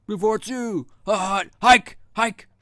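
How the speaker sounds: high, loud quarterback snap count; mock football call. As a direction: bellow it like a quarterback calling the snap.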